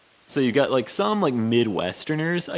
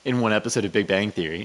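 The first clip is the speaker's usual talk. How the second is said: normal talking voice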